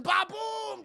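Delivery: loud, high shouted boom; explosion noise. Retold or imitated. Streamer recounts windows blowing out, shouted explosion sound. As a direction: burst out an imitated blast.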